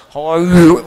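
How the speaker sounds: "How are you" pushed loud, its h sounds thickened; hammed-up try at English with an Arabic throat sound; mock failed English. Shown as a demonstration. Teacher shows the dha sound cannot fit English, mock attempt.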